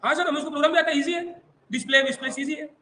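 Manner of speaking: a touch of an eager pupil piping up; confident student. Acted. Teacher imitating a student who knows the program, lightly confident.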